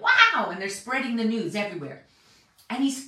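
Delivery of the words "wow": much higher, louder astonished wow; amazed wow. Retold or imitated. Crowd reacting to the raised girl, astonished. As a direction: gasp it out like a stunned crowd. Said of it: "They're like".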